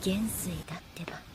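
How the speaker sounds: quick, soft, whispered correction; hushed, fast aside; under-breath whisper. Acted. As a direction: mutter the correction quietly.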